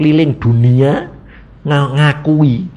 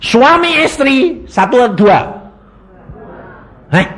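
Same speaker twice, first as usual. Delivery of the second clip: insistent questioning; 12 semitones higher than usual. Real, not acted. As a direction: press the question home, pitched high. Pressing congregation to answer. (bertanya mendesak dengan nada tinggi)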